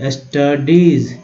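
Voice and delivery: "Studies" slow, split into syllables; broken-down pronunciation. Shown as a demonstration. Teacher repeating a word for learners, slow syllable-by-syllable "studies". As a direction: stretch "studies" as s-ta-deez. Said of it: "ऐसे तोड़ के पढ़ना है".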